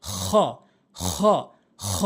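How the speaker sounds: খ with rasping friction from the throat; throaty kh. Shown as a demonstration. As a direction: scrape each খ out at the back of the throat. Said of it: "এরকম শব্দ করে উচ্চারণ করবেন".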